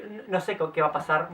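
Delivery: usual speaking voice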